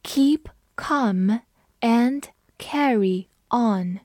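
very slow, one word at a time; slow read-along. Shown as a demonstration. Teacher leads learners through a phrase, slow spaced-out reading. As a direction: leave a clear gap after each word. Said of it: "跟着我慢读一遍".

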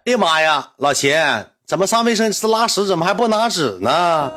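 high-pitched mock exclamation; friend's voice message. Friend replying by voice message to a paper request, mock exasperation. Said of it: "给我发的语音".

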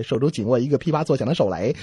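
ordinary delivery

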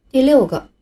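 ordinary talk, nothing marked

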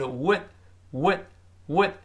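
careful model of "would", one at a time; corrected would. Shown as a demonstration. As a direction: round the lips then open them for "would", d held. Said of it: "不是发呜，不行，是would".

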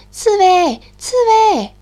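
loud, high shout; calling out. Acted. The mole shouts for the sleeping hedgehog. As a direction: shout across to wake him.

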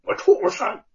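high, furious yell; explosive outraged cry; enraged roar. A narrator's reading. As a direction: hurl the insult after a slap. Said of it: "愤怒地吼道".

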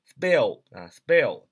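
"spell" slow and loud with a b-like p; p read as b. Shown as a demonstration. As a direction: punch out "spell" as "sbell". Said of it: "要读B啊，B".

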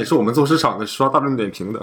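normal talking voice

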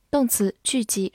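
usual speaking voice

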